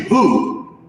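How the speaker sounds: usual speaking voice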